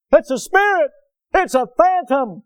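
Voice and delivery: high, loud scared shout; frightened cry. Retold or imitated. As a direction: cry out like terrified men in a storm. Disciples hollering in fear.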